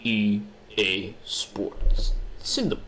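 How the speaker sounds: drawn-out EA Sports slogan voice; very slow announcer. Pretend. Quoting the EA Sports intro, very slow. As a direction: stretch it like the game intro.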